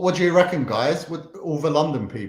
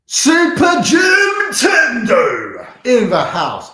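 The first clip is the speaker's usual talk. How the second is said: high, loud sung-out announcement; hype shout; 10 semitones higher, 14 dB louder, much slower than usual. Acted. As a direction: belt it like a ring announcer, stretching the end. Hyping a viewer's name.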